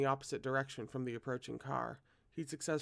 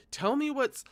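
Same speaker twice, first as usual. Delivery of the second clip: loud, high, urgent demand; 11 semitones higher, 7 dB louder than usual. A narrator's reading. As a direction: grab him and demand an answer. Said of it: "Zhao Yunlin demanded".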